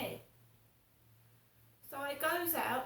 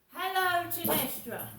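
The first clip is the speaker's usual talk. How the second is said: imitating mum's bright Hello, pitched up and loud; mum's sing-song greeting; 6 semitones higher, 8 dB louder than usual. Imitating mum greeting her daughter on the phone, high sing-song. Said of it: "Not, "Hello, Crystal".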